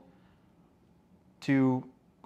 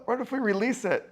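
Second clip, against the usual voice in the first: normal talking voice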